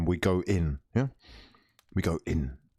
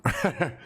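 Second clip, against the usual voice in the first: short laugh; 7 semitones higher than usual. Real, not acted. Laughing at chat.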